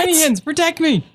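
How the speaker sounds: high-pitched pretend cry for protection; mock plea. Player acting out shielding with tiny hands, mock plea.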